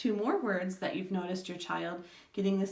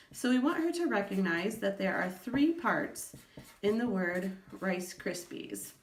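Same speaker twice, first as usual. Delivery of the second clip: normal talking voice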